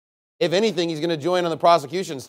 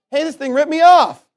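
higher, louder indignant protest; mock outraged complaint; 7 semitones higher, 8 dB louder than usual. Customer complaining about the ATM that took money, mock outrage. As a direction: complain loudly like a cheated customer.

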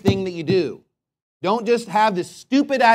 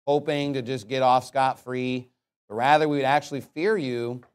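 lower, measured praying voice; closing prayer; 5 semitones lower, 3 dB quieter than usual. Real, not acted. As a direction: lead the congregation in prayer, low and restrained.

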